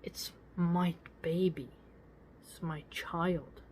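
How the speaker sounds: hushed, slow mother's whisper; soft mother's vow; whispered devotion. Pretend. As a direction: breathe it like holding a newborn. Mother claiming her child.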